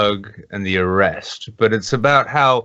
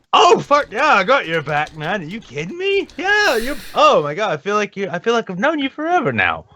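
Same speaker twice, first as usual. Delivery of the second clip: high-pitched instant best-buddy gushing; gushing stranger; 11 semitones higher than usual. Acted. Voicing total strangers backing Count Dankula, gushing high and excited. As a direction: gush like a brand-new lifelong friend. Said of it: "who've never met this guy, who are like".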